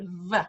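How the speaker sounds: "V" as a buzzed teeth-on-lip sound; hard English V. Shown as a demonstration. Teacher showing the sound Spanish lacks, hard V. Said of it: "there's no hard V".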